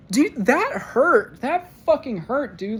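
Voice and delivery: high-pitched, drawn-out wounded protest; hurt outcry. Real, not acted. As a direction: wail how much it stung. Voicing the hurt of that confession.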